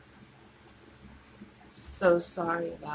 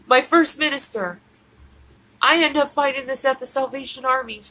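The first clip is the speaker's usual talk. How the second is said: distraught shout; 3 semitones higher, 7 dB louder than usual. Real, not acted. Distraught crying outburst.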